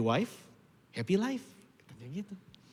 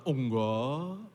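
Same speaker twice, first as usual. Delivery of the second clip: mock denial; 2 semitones lower, much slower than usual. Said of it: "Kamu kepahitan?".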